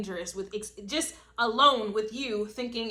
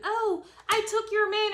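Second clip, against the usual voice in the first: high, snippy mock voice of a gossip; mocking a petty rival; 11 semitones higher than usual. Jealous rival taunts listener about her man, high mock voice. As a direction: squeak it out like a catty rival.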